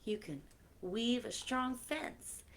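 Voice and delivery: lilting storybook reading; reading to children. Acted. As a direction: read the rhyme to the kids with playful lilt. Reading new book aloud.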